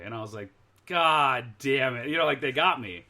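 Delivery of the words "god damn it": slow, loud, drawn-out retold curse; exasperated curse. Sailor caught out by Shore Patrol, exasperated curse. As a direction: stretch out the mock dismay of being caught.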